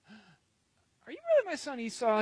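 high-pitched, suspicious questioning; doubting question. Acted. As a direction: ask it high, as a doubting father. Isaac questions his son doubtfully.

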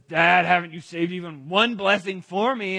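loud, acted indignation; angry complaint. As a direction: demand it loudly of your father. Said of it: "He's angry. He says".

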